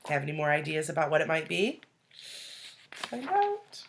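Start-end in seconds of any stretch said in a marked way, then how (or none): 2.9-3.8 high teasing voice. Acted. Teasing hook to read on.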